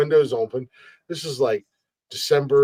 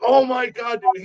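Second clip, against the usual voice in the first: high-pitched awe; thrilled fan; 10 semitones higher than usual. Retold or imitated. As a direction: gush it high, starstruck. Fan thrilled to meet host.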